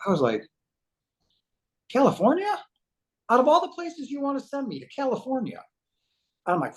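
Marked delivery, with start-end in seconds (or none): 1.9-5.6 high-pitched retold protest; incredulous dismay. Man hearing he must move to California, incredulous. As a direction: pitch it way up in disbelief.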